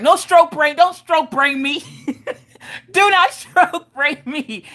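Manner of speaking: high-pitched, laughing objection; playful squealed telling-off; mock protest. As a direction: protest high and slow, cracking up.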